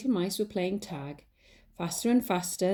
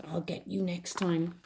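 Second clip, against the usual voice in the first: slow, softer menacing snarl; quiet snarl; 5 dB quieter than usual. Acted. Cat glaring into the mouse hole, slow soft snarl.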